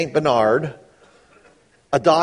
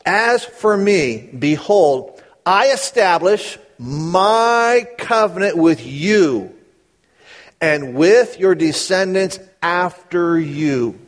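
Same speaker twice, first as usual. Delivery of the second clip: slow, raised solemn reading; slow scripture reading; 3 semitones higher, 3 dB louder, much slower than usual. Acted. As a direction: lay each word down slowly and solemnly. Reads scripture slowly.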